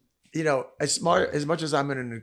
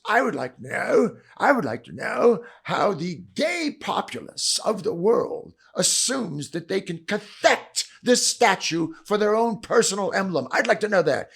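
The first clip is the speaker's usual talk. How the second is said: higher, louder, slow British barrister voice; James Bond-like British voice; 7 semitones higher, 4 dB louder, slower than usual. Retold or imitated. Barrister challenging the attacker, in a slow British Bond voice. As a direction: lay out the question like a suave British spy, slowly. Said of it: "but sounds like James Bond".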